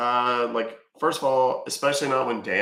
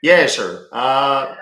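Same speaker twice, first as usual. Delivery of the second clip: loud country caller, a hint of a put-on yokel; mock caller; 7 dB louder than usual. Baiter to scam agent, loud country caller voice. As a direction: play the gullible customer, louder.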